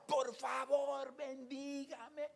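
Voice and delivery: high, loud, slow begging; pleading cry. Retold or imitated. Man clinging to the preacher's hand, crying out loudly. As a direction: beg for the blessing out loud. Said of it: "y me gritaba".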